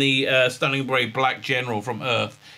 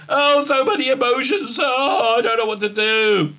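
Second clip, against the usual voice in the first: high, wailing fake distress; overwhelmed, hammed-up helplessness; mock freaking out; 9 semitones higher, 3 dB louder than usual. Woman overwhelmed by her emotions, mock distraught wail. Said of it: "she starts freaking out".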